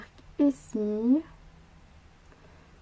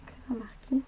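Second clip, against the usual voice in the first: whispering; 8 dB quieter than usual. Real, not acted. Aside, unvoiced.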